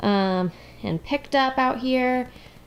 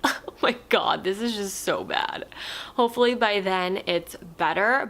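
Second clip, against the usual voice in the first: ordinary talk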